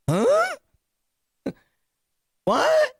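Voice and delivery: very high, squeaky disbelief with a laugh; incredulous squeak. Retold or imitated. Narrator reacts to the robbery, squeaky incredulous disbelief. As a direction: react like you can't believe it.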